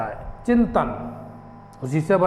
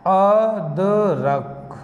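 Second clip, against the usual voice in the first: अदरक drawn out slowly, sound by sound; slow syllable by syllable; much slower than usual. Shown as a demonstration. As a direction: spell out अदरक slowly for a child. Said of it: "जैसे मैंने अभी बताया था". (धीरे धीरे अक्षर अलग करके)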